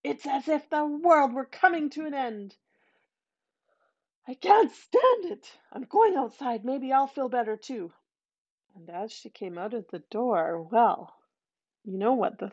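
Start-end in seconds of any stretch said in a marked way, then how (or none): 0.0-7.9 higher, anxious cry; dread, the world ending; frightened wife. A narrator's reading.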